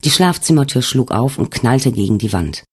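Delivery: usual speaking voice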